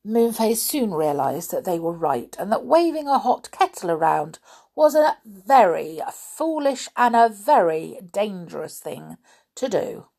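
ordinary delivery, nothing marked

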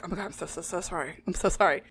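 very soft, low mock apology; hushed, sheepish imitation of apologizing; mumbled apology. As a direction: mumble sorry as if cowed. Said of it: "Mumbles a little appall".

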